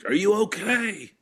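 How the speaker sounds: high, loud mock doctor yell; retold doctor yell. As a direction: shout it into the patient's face. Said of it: "Like, yelling at my family".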